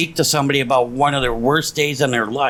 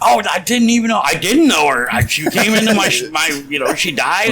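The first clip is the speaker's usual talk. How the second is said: higher, stumbling mock denial; flustered excuse-making; 4 semitones higher than usual. Funeral director denies knowing the dead woman, mock flustered denial. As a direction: stammer out a put-on excuse, pitched up.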